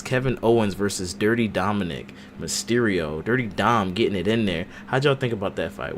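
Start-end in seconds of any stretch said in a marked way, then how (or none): none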